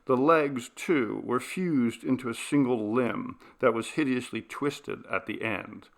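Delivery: ordinary delivery, nothing marked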